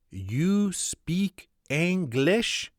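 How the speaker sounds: put-on very slow, high French-accented English; broken English. Footman asking the American, very slow broken English. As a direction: stretch out each word in a French accent. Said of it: "says in broken English".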